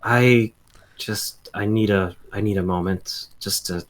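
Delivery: low, soft and halting, trailing away; dazed, processing. Acted. Revived character asking for a moment after dying, dazed and quiet. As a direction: falter quietly, still taking it all in. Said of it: "Kelby's just trying to process exactly what happened".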